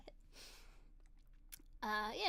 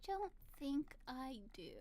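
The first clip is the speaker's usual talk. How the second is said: usual speaking voice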